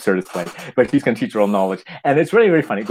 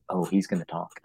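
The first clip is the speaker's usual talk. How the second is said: softer, lower aside; audience aside; 4 semitones lower, 7 dB quieter than usual. Retold or imitated. Theatergoer to a neighbor about the boy, hushed. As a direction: murmur it like a theatergoer.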